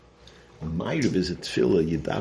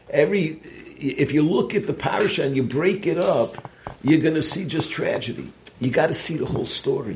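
impassioned preacher, raised pitch; preaching; 9 semitones higher, 3 dB louder than usual. Acted. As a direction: preach it with fire. Preaching at full force, higher.

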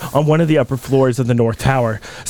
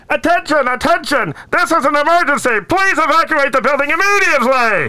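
through a megaphone; 8 semitones higher than usual. Acted. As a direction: call out the evacuation like on a megaphone. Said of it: "Through the megaphone".

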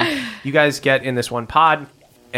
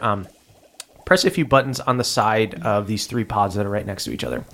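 ordinary delivery

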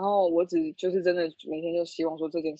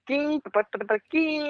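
dial-up modem noise; 7 semitones higher than usual. Retold or imitated. Recalling dial-up internet sounds.

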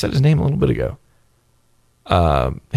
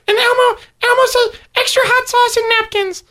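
very high, squeaky Elmo narrating himself. Retold or imitated. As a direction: Elmo talking about Elmo. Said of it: "the Elmo voice".

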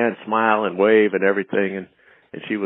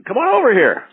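high, louder call from the car; driver calling out; 4 dB louder than usual. Retold or imitated. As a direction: hail him over from the car window. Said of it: "the driver screams out at me".

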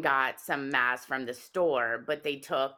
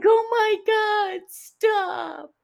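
high, loud mock-frightened plea; hammed-up tearful squeal, drawn out; retold crying; 7 dB louder, slower than usual. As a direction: imitate a panicked friend begging. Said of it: "She starts crying".

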